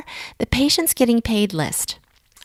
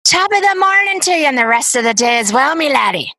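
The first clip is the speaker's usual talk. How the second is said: hammed-up stage Irish accent, pitched higher; Irish brogue; 6 semitones higher than usual. Host showing off her Irish brogue to her Irish guest, hammed-up and higher. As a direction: lay on a playful Irish brogue with a St. Patrick's Day greeting. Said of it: "So here's my Irish brogue".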